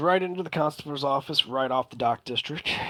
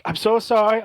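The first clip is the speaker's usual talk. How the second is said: high, louder flustered apology; 8 semitones higher, 7 dB louder than usual. Acted. Waitress rushing out to apologize, high and flustered. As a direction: hurry in apologizing.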